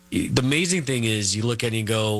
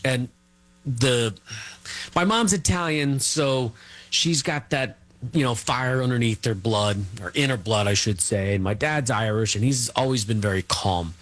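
normal talking voice

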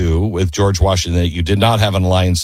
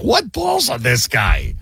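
mimicked amazement; 3 semitones higher, slower than usual. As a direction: imitate someone blurting it in disbelief. Curator mimics host's earlier outburst.

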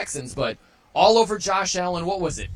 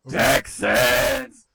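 announcer call; 8 semitones higher, 5 dB louder than usual. Retold or imitated. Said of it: "the guy in the loudspeaker just goes".

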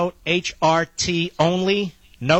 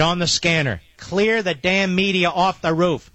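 normal talking voice

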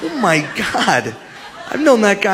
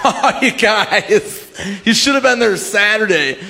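show-off storytelling; 5 semitones higher, slower than usual. Retold or imitated. Acting out bragging to friends.

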